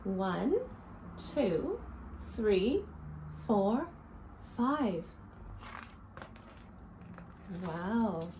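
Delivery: lively storybook read-aloud for little ones; reading to children. A narrator's reading. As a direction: read it to a circle of kids, counting along. Reads picture book to children.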